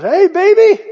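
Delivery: high, loud mocking catcall from the bar; imitating a barfly's loud high catcall. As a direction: sing out a leering hey baby. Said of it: "somebody goes".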